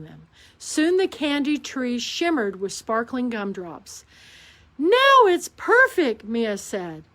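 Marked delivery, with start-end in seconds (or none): 4.8-6.2 put-on delighted child. A narrator's reading.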